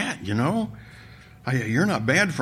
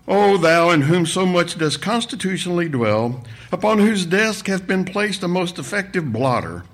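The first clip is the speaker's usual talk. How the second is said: mock-pious droning prayer, pitched up at first; loud monotone; 4 semitones higher than usual. A narrator's reading. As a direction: intone it like pompous old scripture. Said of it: "a loud monotone".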